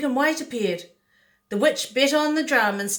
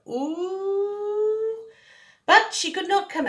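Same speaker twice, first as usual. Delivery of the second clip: witch ooh; 11 semitones higher, much slower than usual. Acted. Witch watching from outside.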